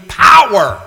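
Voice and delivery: sudden loud shout on power; a preacher's shouted climax on a single word. Acted. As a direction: hit power with full force.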